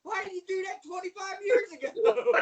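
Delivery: high-pitched, incredulous raving; amazed exclamation. Real, not acted. Guest raving about Al Snow's new look, amazed. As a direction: marvel at him in a high, worked-up voice.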